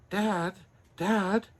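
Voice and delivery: high, slow joyful calling; a boy thrilled to be real; overjoyed. Acted. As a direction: wake him with the happy news.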